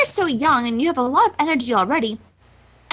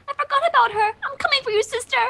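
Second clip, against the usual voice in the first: high anxious rush; 7 semitones higher than usual. Acted. Rarity panicking over sister.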